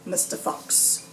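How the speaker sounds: usual speaking voice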